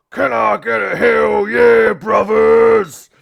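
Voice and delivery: booming slow showman's roar to the crowd; wrestler hype shout. Acted. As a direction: work the crowd like a pro wrestler with a megaphone. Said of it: "holds up to his mouth and goes".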